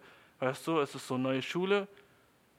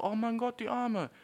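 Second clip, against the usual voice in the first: higher-pitched pitying gush; mitleidiges Bedauern; 9 semitones higher than usual. Acted. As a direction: gush with pity for a poor girl. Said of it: "Bei Mädchen ist es so". (hohes, mitleidiges Bedauern)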